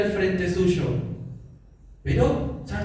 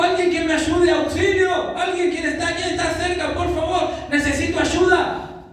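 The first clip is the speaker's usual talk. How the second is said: frantic cry for help; 8 semitones higher than usual. Pretend. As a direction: yell to anyone nearby. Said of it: "No dijo".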